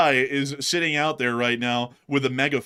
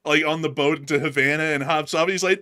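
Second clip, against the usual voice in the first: ordinary delivery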